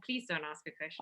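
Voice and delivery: a quiet, pleading teacher aside; mock reluctant teacher. Teachers voiced discouraging questions.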